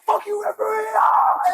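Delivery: hammed-up shout at the referee; mock yell at referee. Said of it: "We're like".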